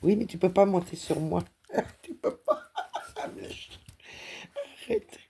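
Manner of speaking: high, giggling protest at the cat; squeaky laughing scold; laughing pet talk. Real, not acted. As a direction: laugh while telling the cat no.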